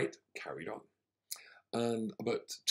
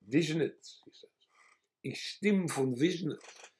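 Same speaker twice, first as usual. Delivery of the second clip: put-on Yiddish accent; 6 semitones higher, 5 dB louder, slower than usual. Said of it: "And so he goes".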